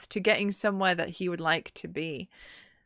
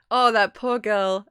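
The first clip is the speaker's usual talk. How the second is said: ordinary talk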